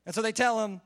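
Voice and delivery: ordinary talk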